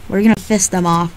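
ordinary talk

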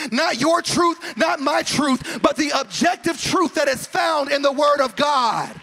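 preacher building to a shout, then the call to the church; preaching at full force. Acted. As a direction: hammer each truth home to the church. Preaching climax on one truth.

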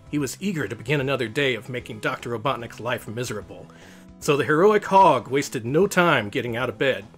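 ordinary delivery

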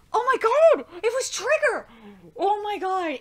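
high, very loud astonished outburst; shocked screaming. Real, not acted. Reader realising the shot was Trigger, screaming in shock. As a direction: scream it in thrilled disbelief. Real shock at story reveal.

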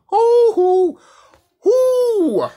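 high loud hoo hoo cry; mock hooting. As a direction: whoop it out high like a taunt. Mocking karma catching evil beings.

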